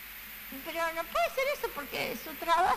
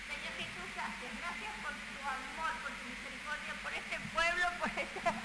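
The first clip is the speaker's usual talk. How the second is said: hushed, much softer prayer; quiet prayer; 12 dB quieter than usual. Real, not acted. Preacher starts praying to the Lord in a soft murmur. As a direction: pray almost under your breath. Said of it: "no estoy hablando, ya hablo fuerte".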